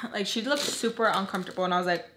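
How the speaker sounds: usual speaking voice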